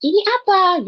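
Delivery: high, loud startled "Ini apa?"; shocked. Shown as a demonstration. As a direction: blurt "Ini apa?" in surprise. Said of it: "seolah-olah gitu".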